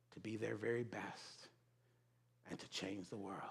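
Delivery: put-on soft, earnest young admirer; earnest plea. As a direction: ask your hero quietly and sincerely. Young self earnestly asks his hero.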